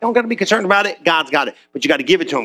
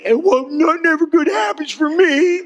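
whiny complaint; 7 semitones higher than usual. Pretend.

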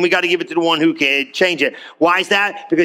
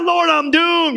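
high, pleading cry to the Lord; desperate cry; 9 semitones higher, slower than usual. Retold or imitated. Preacher voicing a doomed believer, in a high desperate cry. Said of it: "screamed out".

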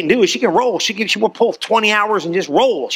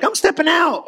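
high-pitched, eager re-enactment; bold declaration; 9 semitones higher than usual. Retold or imitated. Preacher recalling his own step of faith, acted high and eager. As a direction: announce it eagerly, pitched well up.

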